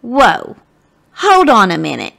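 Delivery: ordinary delivery, nothing marked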